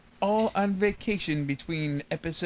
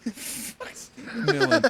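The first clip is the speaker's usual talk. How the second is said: laughing; 9 semitones higher, slower than usual. Real, not acted. Cracking up over the no sell.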